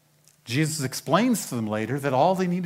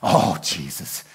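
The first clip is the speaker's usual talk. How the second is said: low, disappointed sigh of a scolding; exasperated reproach; 10 semitones lower than usual. Acted. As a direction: lower voice, as if let down. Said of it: "Do you think Jesus said it this way?".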